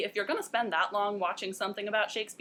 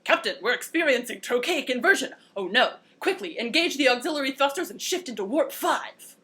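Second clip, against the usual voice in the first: hammed-up sci-fi emergency, higher and louder; alarmed starship crew; 4 semitones higher, 5 dB louder than usual. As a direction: bark out a mock starship crisis. Acted sci-fi crew in crisis.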